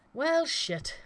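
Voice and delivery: ordinary delivery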